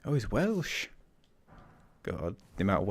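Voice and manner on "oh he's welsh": put-on a light, poor Welsh accent, a bit higher. Streamer mimicking a Welsh game character, poor Welsh accent. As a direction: toss off a quick hammed-up Welsh lilt. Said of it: "poor accent there".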